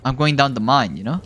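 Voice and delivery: ordinary talk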